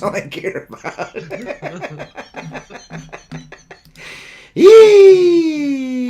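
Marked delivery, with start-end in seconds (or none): 4.5-6.1 very high, very loud yee-haw cry; wild yee whoop. Acted. Joking about barren wheat fields, a loud high whoop. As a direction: let out a wild hillbilly whoop.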